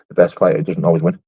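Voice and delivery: low-pitched comment read-out; reading aloud. Real, not acted. Streamer reading out a viewer's comment, low read-out. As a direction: read it out low and matter-of-fact.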